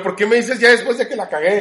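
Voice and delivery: loud, high, outraged yelling; indignant rant. Retold or imitated. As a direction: yell it at the know-it-alls. Ranting at know-it-all fortune tellers.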